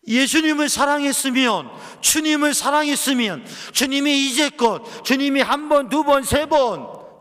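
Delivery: loud, high impassioned sermon cry; pleading with the congregation, full force; fervent preaching. Acted. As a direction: preach it out at the top of your voice.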